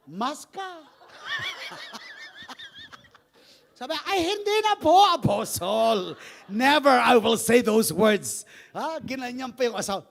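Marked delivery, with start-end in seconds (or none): 0.6-3.2 very high burst of laughter; high squealing laugh. Real, not acted. Preacher to congregation, high squealing laugh. 4.1-8.5 loud, slow earnest promise; loud mock vow. Wife to apostle, a loud slow vow.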